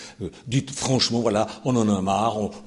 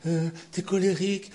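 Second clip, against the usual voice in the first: mocking critic; 9 semitones higher than usual. Pastor lightly mimicking critics who call him angry. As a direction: imitate a whiny critic lightly. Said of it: "Il y en a qui disent".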